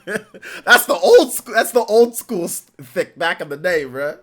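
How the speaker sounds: high, loud laughing words. Real, not acted. Laughing at Dexter's mom.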